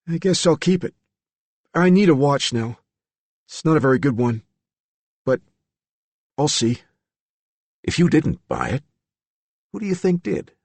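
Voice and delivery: lightly voiced uneasy young man. A narrator's reading. Billy voiced higher throughout.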